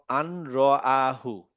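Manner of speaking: আন র আহু drawn out very slowly, each part held; slow recitation. Shown as a demonstration. Teacher to student, reciting আন র আহু very slowly. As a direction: stretch out আন র আহু syllable by syllable. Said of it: "র দেখেন".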